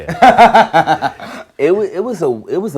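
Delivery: loud, high burst of laughter into speech; laughing agreement. Real, not acted. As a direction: start answering while still laughing hard. Laughing at interviewer's funding remark.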